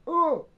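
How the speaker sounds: sudden high-pitched yelp of fright; mock panicked "Oh!". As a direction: squeal it out like a startled man. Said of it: "he goes".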